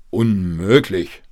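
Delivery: loud, drawn-out indignation; scolding father. A narrator's reading. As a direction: scold slowly and forcefully. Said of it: "schimpfte der Vater". (laut schimpfender Vater)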